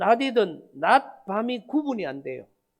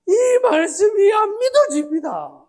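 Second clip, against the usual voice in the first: sobbing cry, much higher and louder; 10 semitones higher, 9 dB louder than usual. Retold or imitated. Man crying to pastor he cannot believe, sobbing. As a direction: wail it out through sobs. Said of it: "울면서 하시는 말씀이".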